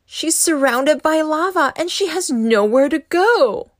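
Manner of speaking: plain reading voice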